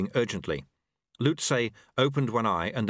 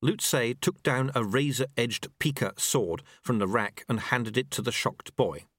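ordinary delivery

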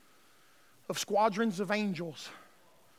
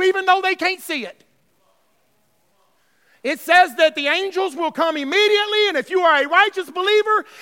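loud, raised preaching voice; full-force preaching; 7 semitones higher, 13 dB louder than usual. Real, not acted. Preacher telling the church that angels come at death, full-force preaching. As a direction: build to a shout, then ease at the end.

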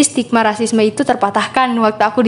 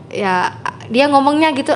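usual speaking voice

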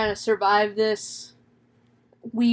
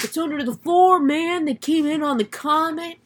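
high-pitched insistent raving; crazy rambler; 6 semitones higher than usual. Retold or imitated. Ezra explains the voices to Ward, acted crazy rambling. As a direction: ramble it like a conspiracy believer. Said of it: "just crazy person rambling bullshit".

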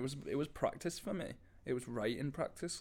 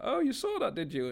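suddenly high, louder opening; retold own greeting; 13 semitones higher, 5 dB louder than usual. Singer to family watching on FaceTime, high-pitched. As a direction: jump up high, then ease down. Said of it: "and I was like".

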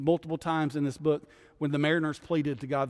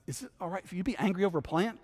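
rising question, fast; 6 semitones higher, faster than usual. Retold or imitated. God's question voiced by preacher.

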